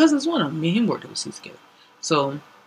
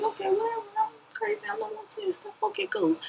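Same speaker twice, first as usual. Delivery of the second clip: high, soft resigned giving up; 6 dB quieter, faster than usual. Retold or imitated. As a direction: shrug it off high and fading. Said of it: "it was just like".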